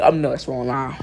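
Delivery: ordinary delivery, nothing marked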